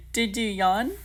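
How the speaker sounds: asking through a giggle; laughing. Real, not acted. Teasing viewers about contagious yawns, laughing. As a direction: tease them while laughing.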